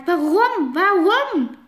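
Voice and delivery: loud, high, rising "Warum?" twice; angry demand. Acted. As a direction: demand an answer. Friend demands why, louder.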